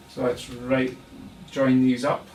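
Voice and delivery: ordinary delivery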